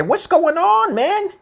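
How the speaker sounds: very high, exaggerated casual hail; high-pitched greeting. Shown as a demonstration. Teacher showing different expressions for greeting people, high-pitched hail. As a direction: squeal it out as a mock buddy greeting.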